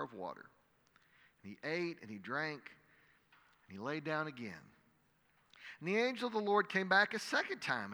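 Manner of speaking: measured, dramatic Bible reading to a congregation; scripture reading. Acted. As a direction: read the passage aloud like a preacher. Reads the scripture passage.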